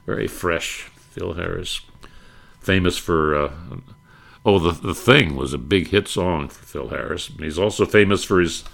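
ordinary delivery

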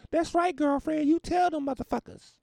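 put-on very high, loud girlfriend falsetto; cheering supporter. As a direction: egg her on in a squeaky mock voice. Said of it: "people cheering her on".

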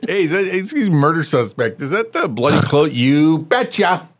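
hammed-up questioning of a suspect; mock interrogation. Co-host playing questioner and eager suspect, hammed-up.

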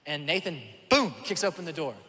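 usual speaking voice

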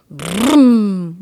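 loud, ringing broom like an engine; motorcycle noise. A narrator's reading. Boy making his motorcycle go, loud engine broom. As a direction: roar out a kid's toy-bike vroom. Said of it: "went the boy".

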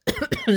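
sudden sputtering cough; choking cough. Real, not acted. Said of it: "I'm choking on my own spit".

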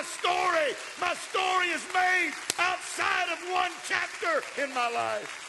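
loud, high, rising sermon climax; climbing preacher's cry; full-force preaching. Acted. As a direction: preach it out to the whole house, building. Preaching to the congregation.

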